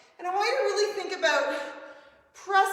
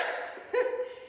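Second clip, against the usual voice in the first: laugh; 6 dB quieter than usual. Real, not acted. Laughing at own breathing echo.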